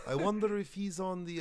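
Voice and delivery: high, curious mock Russian; Russian accent. Russian fan looking for the host online, Russian accent. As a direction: wonder aloud in a Russian accent. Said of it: "My, my Russian accent".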